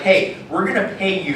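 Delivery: normal talking voice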